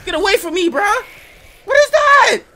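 loud high panicked yell; scared yelling. Real, not acted. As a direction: yell at it to get away. Fleeing monster.

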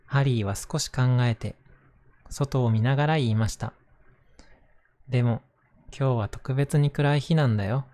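gentle storybook narration voice; reading to children. Acted. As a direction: read it aloud to little kids. Reads a picture book aloud.

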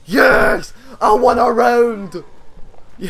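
loud, high, slow cheer of relief; triumphant yell. Real, not acted. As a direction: savour the first round won. Finally winning a round.

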